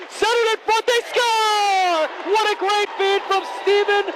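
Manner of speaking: high, excited goal call; long goal yell. Real, not acted. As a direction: stretch out they score. Goal call in hockey play-by-play.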